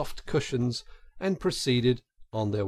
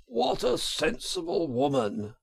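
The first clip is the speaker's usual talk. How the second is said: kindly gentleman; 11 semitones higher than usual. A narrator's reading. Gentleman voiced in story.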